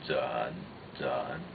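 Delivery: "Zhen" dropped low and soft; third tone. Shown as a demonstration. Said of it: "That's a third tone".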